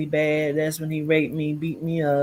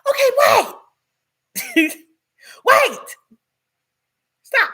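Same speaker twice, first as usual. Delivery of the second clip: panicked pleading; 6 dB louder than usual. Retold or imitated. Begging as hammer raised.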